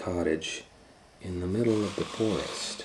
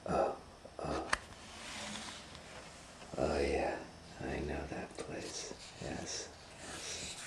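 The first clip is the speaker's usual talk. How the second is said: soft, slow, fading to a whisper; scheming wolf; 3 semitones lower, 10 dB quieter, slower than usual. Acted. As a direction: mutter it greedily to himself. Wolf plots quietly.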